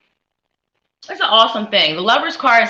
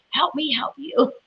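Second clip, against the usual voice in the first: laughing; 5 semitones higher than usual. Real, not acted. Pleading with confusing viewer comment.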